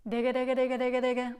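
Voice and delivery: repeated digge demo; digge drill. Shown as a demonstration. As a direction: rattle off digge as double tonguing. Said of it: "everything going from digge until tikke".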